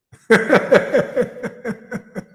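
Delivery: loud, high burst of laughter; long run of real, tickled laughter; hearty laugh. Real, not acted. Preacher tickled by devotees worming their way in, laughing.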